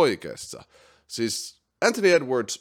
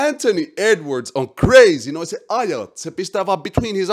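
hyped; 9 semitones higher, 9 dB louder than usual. Real, not acted. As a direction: sell how crazy he is, loud. Hyping a young star.

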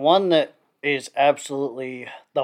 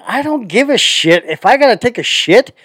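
louder and higher, laughing defiance; loud defiant rant; 7 semitones higher, 10 dB louder than usual. Real, not acted. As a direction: belt it out like you truly don't care.

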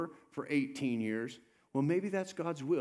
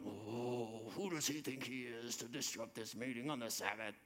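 mild muttered indignation; 2 semitones lower, 11 dB quieter than usual. Retold or imitated. Meeting head muttering indignation.

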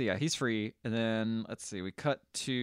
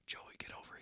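whisper; 19 dB quieter than usual. Retold or imitated. Radio voice calling Joey.

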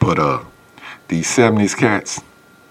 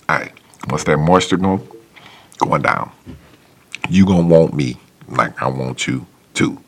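usual speaking voice

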